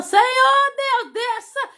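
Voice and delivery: high, slow cry to the Lord; drawn-out high prayer cry, heaven-bound; crying out to God. Retold or imitated.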